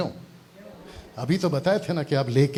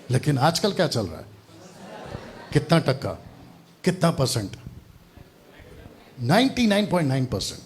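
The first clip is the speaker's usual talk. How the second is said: ordinary talk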